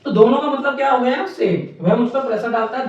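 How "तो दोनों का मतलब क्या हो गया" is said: ordinary delivery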